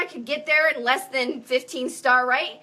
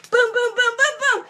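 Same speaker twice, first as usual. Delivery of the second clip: high, punchy string of booms; comic high-pitched crash noises; crashing plates; 7 semitones higher, 3 dB louder than usual. Acted. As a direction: act out one crash after another.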